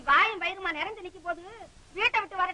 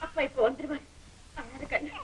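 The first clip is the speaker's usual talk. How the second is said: put-on soothing a baby; 2 semitones lower, 6 dB quieter than usual.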